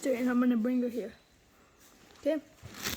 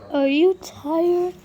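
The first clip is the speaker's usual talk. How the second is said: higher, louder and slow, drawn-out coo; baby talk; 4 semitones higher, 8 dB louder, much slower than usual. Shown as a demonstration. Owner cooing at her sleepy puppy, higher and slow. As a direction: stretch it into a sing-song coo.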